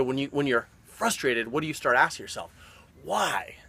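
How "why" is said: whispered why. Retold or imitated. Coach voices a frustrated mind asking why, whispered. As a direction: breathe the question out in doubt. Voicing frustrated self-questions.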